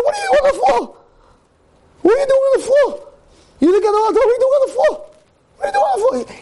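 very high, frantic shouting; imitated screaming. Rabbi to the man on the lobby floor, imitated screaming. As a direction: shriek it over and over, as the appalled rabbi. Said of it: "he's hearing him screaming".

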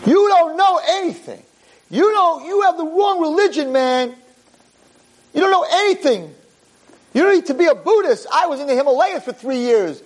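mock angry yell. Howie yelling at the rabbi in the parking lot, high whining rant.

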